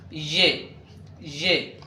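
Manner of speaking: ي pronounced "zhe", as Urdu ژے; correct letter sound. Shown as a demonstration. Teacher repeats the correct sound for learners, ي as "zhe". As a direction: open ي on a buzzing zh. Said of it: "هذا هو نطقه الصحيح".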